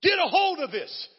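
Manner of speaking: high, loud preacher's cry; rally the congregation with a shout; fired-up pulpit call; preaching at full force. Acted.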